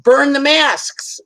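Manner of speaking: hammed-up defiant slogan; defiant rebel. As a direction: shout it like a know-it-all rebel. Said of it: "Sag on the South Node".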